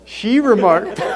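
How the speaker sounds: laughing. Real, not acted. Officiant laughing mid-speech.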